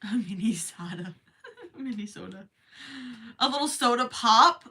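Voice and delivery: mock Minnesota accent, long o in soda. Streamer playing along with chat mocking her Minnesota accent. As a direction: ham up the Minnesota vowels. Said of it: "making fun of my accent".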